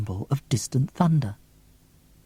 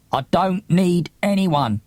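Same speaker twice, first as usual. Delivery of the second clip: mild shout; 6 semitones higher than usual. A narrator's reading. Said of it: "he shouts".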